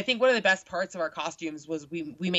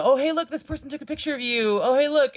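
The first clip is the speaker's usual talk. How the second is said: higher, eager mock call-out; imitated excited followers; 8 semitones higher, 4 dB louder than usual. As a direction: point it out like a keen follower. Acting out followers tagging her.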